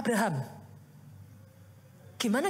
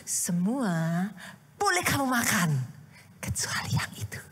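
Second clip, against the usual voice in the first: 1 tempting instruction; 6 semitones lower than usual. Retold or imitated. God's command voiced as parent.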